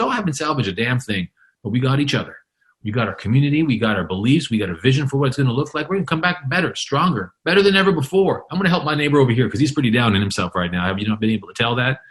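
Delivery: quick, rallying imitated optimism; all excited. Storm survivor vowing to rebuild with neighbours, all excited. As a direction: play the survivor eagerly cheering everyone on. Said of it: "He's all excited about what's going on".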